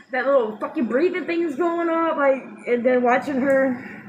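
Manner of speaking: ordinary delivery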